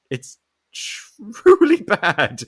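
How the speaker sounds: real laugh breaking up the words; can't keep a straight face about how bad it is; laughing in disbelief. Real, not acted. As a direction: laugh through it, slowly. Laughing at terrible accent.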